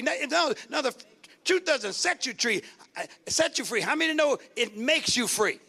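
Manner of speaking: normal talking voice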